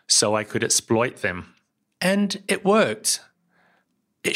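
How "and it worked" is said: drawn out, much higher triumphant line; slow, high little note of triumph; pleased emphasis. Real, not acted. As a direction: savor the win slowly. Payoff of the app story.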